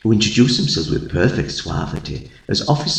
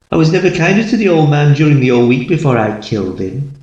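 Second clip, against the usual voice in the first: quick boast; 4 semitones higher, faster than usual. Acted. Narrator boasting of false kindness.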